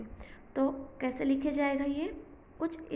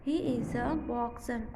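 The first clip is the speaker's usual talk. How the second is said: very slow, words spread out; slow model reading; much slower than usual. Shown as a demonstration. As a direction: read the short form slowly.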